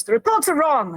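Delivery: agitated. Retold or imitated. Prisoner insisting to Kira, agitated. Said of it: "he's clearly agitated now".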